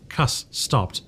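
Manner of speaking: ordinary delivery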